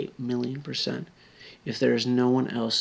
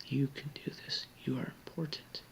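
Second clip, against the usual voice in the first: much softer, tender whisper; 10 dB quieter than usual. Real, not acted. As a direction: whisper encouragement close to them. Encouraging suicidal viewer.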